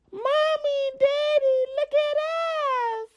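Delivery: put-on high childlike sing-song call; little girl voice. The strange girl calling to her parents, high little-girl voice. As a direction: imitate a small girl calling out sweetly.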